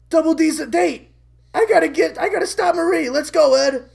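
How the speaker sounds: loud, high acted panic; alarmed urgency. Eddie rushing to stop Marie, loud panicked urgency. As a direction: blurt it and rally your friend.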